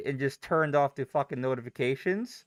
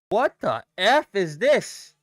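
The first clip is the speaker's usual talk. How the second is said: exasperated; 4 semitones higher, 5 dB louder, slower than usual. Real, not acted. Frustrated at news.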